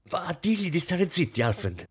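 high-pitched hiss trembling with fury; furious hiss. A narrator's reading. Wife sending husband to silence servants, furious hiss. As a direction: hiss it, barely containing rage. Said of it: "sibilò infine Rosine con voce tremante di furore".

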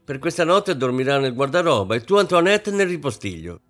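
loud, high-pitched orders; shouting. A narrator's reading. Mother shouting at her daughter to keep out of her room. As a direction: call out sharply to stop her going in. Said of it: "la signora Kampf gridò di nuovo".

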